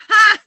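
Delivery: high, very loud "ha"; loud laugh burst. Real, not acted. Host laughing at the ruined road trip, loud cackle. Laughing at her own misfortune.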